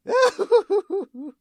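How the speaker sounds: high-pitched villain's laugh; high cackle. Acted. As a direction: burst into a high, falling cackle. Character cackles at the press conference.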